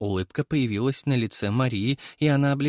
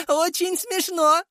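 high, crazed cackle, slow; mad laughter; slower than usual. A narrator's reading. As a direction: laugh like a lunatic. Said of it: "безумно расхохоталась Мария".

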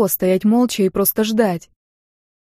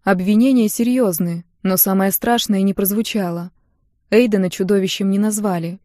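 ordinary delivery, nothing marked

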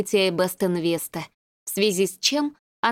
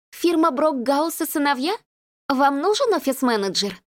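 cheerful phone voice; 6 semitones higher than usual. A narrator's reading. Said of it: "придав своему голосу жизнерадостную интонацию".

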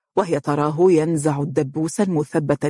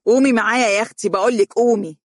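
loud, fast, higher shout; 5 semitones higher, 4 dB louder, faster than usual. A narrator's reading. Stepmother shouting Mohra awake, loud fast yell. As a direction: yell her awake in a rush. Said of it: "صائحة".

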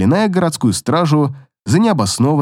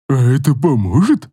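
happy grunt; 2 semitones lower than usual. Acted. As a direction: grunt it out gleefully. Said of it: "обрадованно хрюкнула фея".

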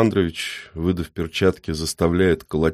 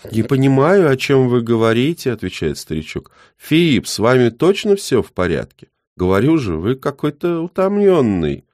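put-on high, loud innocent old man; feigned innocent old man; 6 semitones higher, 4 dB louder than usual.

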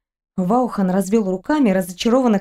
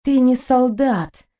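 slow, higher, bitter remark; 4 semitones higher, slower than usual. A narrator's reading. Kristina bitterly telling him, slow and hurt. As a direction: let the disappointment show. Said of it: "горько проговорила Кристина".

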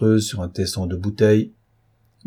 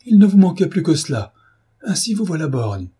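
higher and louder, an indignant housekeeper; exasperated outburst; 8 semitones higher, 5 dB louder than usual. A narrator's reading. Housekeeper scolds the clerk for his lost eye, exasperated and loud. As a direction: burst out at him in dismay.

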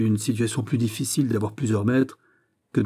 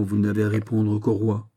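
usual speaking voice

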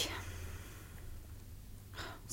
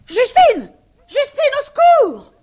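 high, loud terrified scream; screaming for help; 18 dB louder, slower than usual. Acted. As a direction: shriek for the maid in panic. Woman calls maid for help.

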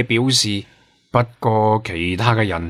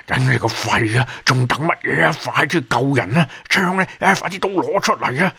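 frantic scolding climbing much higher; cursing in agitation; 5 semitones higher than usual. A narrator's reading. Tofu swearing at the others to save people, frantic. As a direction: urgently hurry everyone into action, pitch rising sharply. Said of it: "係噉鬧到佢粗口".

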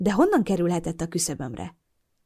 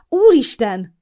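startled, higher and louder; startled cry; 6 semitones higher, 9 dB louder, slower than usual. A narrator's reading. Narrator startled when neighbor suddenly opens door. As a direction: burst out in fright. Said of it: "szakadt fel belőlem".

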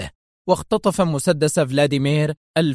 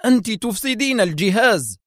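higher, urgent protest; alarmed shout; 8 semitones higher than usual. A narrator's reading. Doctor protesting as she cuts the machine, alarmed shout. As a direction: cry out to stop her wrecking it. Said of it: "فصاح الدكتور أحمد".

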